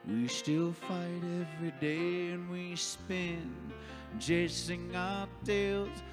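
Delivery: ordinary talk, nothing marked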